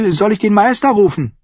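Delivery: put-on high child's voice; high-pitched young lord; boy, concerned. A narrator's reading. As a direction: ask after him in a high boyish voice.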